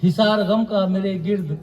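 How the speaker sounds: poetry recitation. Acted. Poet repeating each line for mushaira listeners, deliberate recitation. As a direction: recite verse with measured, deliberate phrasing.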